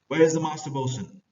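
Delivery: high, loud aggressive demand for the bosun; mildly aggressive nobleman calling for the bosun. Acted. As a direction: bark it at the crew. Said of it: "Antonio is a more aggressive man".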